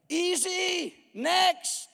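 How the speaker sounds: high, loud taunting devil; screaming devil. Acted. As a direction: gloat like the devil. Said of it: "the devil screams at the crowd".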